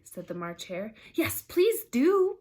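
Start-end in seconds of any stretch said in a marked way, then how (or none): 1.1-2.4 much higher, louder, slow plea; pleading. Acted. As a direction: beg them sweetly to go on. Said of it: "pleaded Alice".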